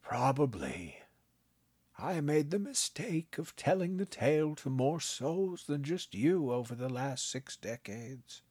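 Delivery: put-on a kindly old man, gently amused; old grandpa voice. A narrator's reading. Grandpa teasing his grandson, kindly old voice.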